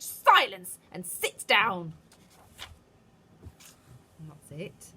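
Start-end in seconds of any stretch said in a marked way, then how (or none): 0.0-1.9 very loud, slow command; commanding witch. A narrator's reading. Witch ordering her audience, loud and slow. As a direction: order the hall to silence.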